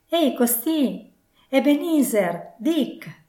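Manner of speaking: high, drawn-out hearty calling; jovial, resonant call. A narrator's reading. Old Fezziwig summoning his two apprentices, jovial ringing call. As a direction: sing out the names, jovial and sonorous. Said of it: "chiamò forte con una voce sonora, gioviale".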